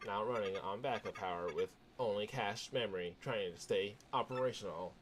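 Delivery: computer voice. Acted. Fortress computer calmly telling Mario about Peach. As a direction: speak like a measured fortress computer.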